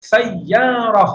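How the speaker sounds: drawn-out teacher model; slow model word. Shown as a demonstration. Teacher to students, slow syllable-by-syllable model. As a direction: stretch the word سیارہ slowly for students to repeat. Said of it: "بولیے".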